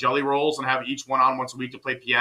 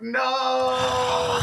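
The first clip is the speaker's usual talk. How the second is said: long, high anguished wail; mock wail; 11 semitones higher than usual. Friend learns the cast members are sent away, mock wail. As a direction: cry it out in drawn-out, hammed-up despair.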